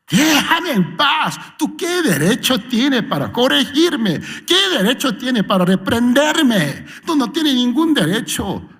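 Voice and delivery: high, indignant back-talk; high mock rude defiance; defiant rebuke. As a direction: snap back at whoever corrects you. Rebellious believer rejecting correction.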